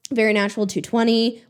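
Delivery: ordinary talk, nothing marked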